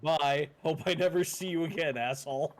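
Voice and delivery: ordinary delivery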